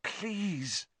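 hushed urgent begging; frantic whisper. A narrator's reading. Hermione begging for help near the willow, high hushed plea. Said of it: "Hermione whispered frantically".

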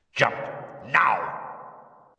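explosive order, each word separate; forceful command. A narrator's reading. Curse losing patience with the resisting boy, forceful command. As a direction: burst out with the command.

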